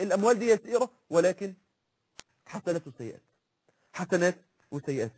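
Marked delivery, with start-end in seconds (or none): 2.5-5.1 much softer, low and grave; hushed warning about good and bad deeds; solemn hush. Solemn close about Judgment Day.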